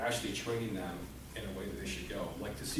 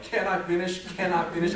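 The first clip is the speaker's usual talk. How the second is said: raised, louder pleading; kid protesting; 7 semitones higher, 8 dB louder than usual. Retold or imitated. Kid protests to dad, raised and louder. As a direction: insist on finishing, higher and louder. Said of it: "I'm like".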